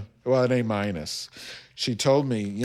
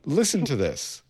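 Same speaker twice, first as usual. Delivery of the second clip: ordinary delivery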